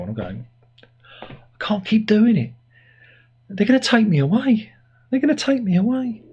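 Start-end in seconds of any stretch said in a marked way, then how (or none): 1.2-6.2 much higher, hammed-up desperate squeal; high-pitched mock pleading. Owner begging dog as neighbours think he's mad, high mock-desperate plea.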